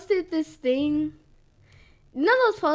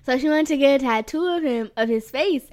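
ordinary delivery

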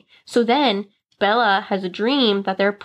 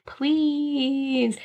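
drawn-out, higher mock begging; pleading; 4 semitones higher, 4 dB quieter than usual. Bella begging her reluctant husband, pleading. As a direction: stretch it into a retold plea.

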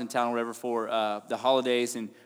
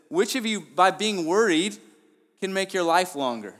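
higher and louder, slowed on key words; impassioned preaching; 7 semitones higher, 7 dB louder than usual. Acted. Preacher pressing Jesus' question on the church, impassioned. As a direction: press the question hard on the listeners. Preacher paraphrasing scripture, rising.

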